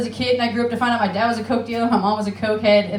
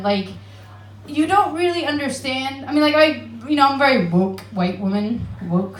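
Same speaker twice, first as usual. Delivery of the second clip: ordinary talk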